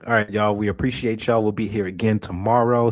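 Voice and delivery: ordinary talk, nothing marked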